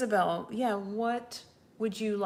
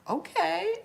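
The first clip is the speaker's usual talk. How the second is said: a high, louder, stunned okay; taken-aback okay; 12 semitones higher, 6 dB louder than usual. Retold or imitated. She retells her reaction to the family member's rage. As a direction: say it bewildered after a sigh. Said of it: "and I was like".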